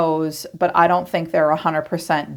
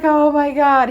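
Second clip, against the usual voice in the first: high, loud gushing Oh my God; 9 semitones higher, 4 dB louder than usual. Retold or imitated. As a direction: gush it out, starstruck. Voicing a smitten man.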